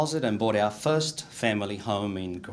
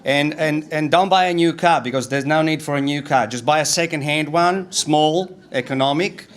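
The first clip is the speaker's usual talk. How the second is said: put-on raised, pushy advice to a young nephew; louder, higher mock lecture from the uncles; bossy uncles lecturing; 5 semitones higher, 7 dB louder than usual. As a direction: hammer every rule home like an old Italian uncle. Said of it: "they said".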